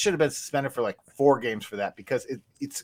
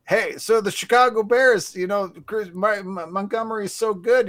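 Chris Collinsworth impression; 8 semitones higher, 4 dB louder than usual. Podcaster mocking the TV commentator, higher sportscaster voice. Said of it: "good Chris Collinsworth impersonation".

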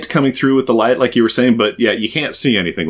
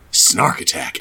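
whispering; 6 semitones lower, 4 dB quieter than usual. Shown as a demonstration. Echoing the title as a whisper.

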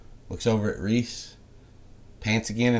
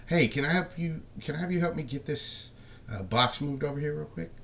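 higher, breezy fake-friendly ask; a higher, casual request to a mover; falsely friendly helper; 7 semitones higher, 3 dB quieter than usual. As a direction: sound helpful while luring him in. Villain voices friendly helper.

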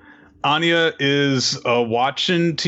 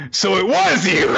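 mock accusation; 8 semitones higher, 4 dB louder than usual. Acts accusation against Bond.

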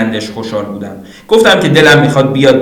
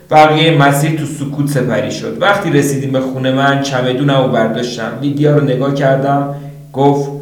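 ordinary delivery

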